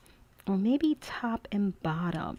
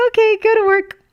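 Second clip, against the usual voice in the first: even higher, louder child imitation; daughter's voice; 12 dB louder, faster than usual. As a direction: squeal it like the delighted kid. Retelling daughter's line laughing.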